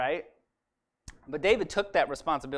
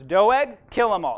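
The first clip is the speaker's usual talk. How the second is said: louder, cold order to kill; ruthless command; 3 semitones higher, 7 dB louder than usual. Acted. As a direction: bark the order with no mercy. King orders Doeg to kill.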